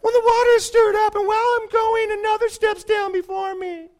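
mock the sick man whining about the pool; whiner. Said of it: "that's what whiners sound like".

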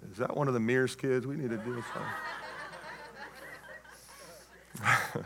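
low, very soft gossiping aside; guest's mutter. Acted. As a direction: trail off under your breath. Guests muttering about the boy.

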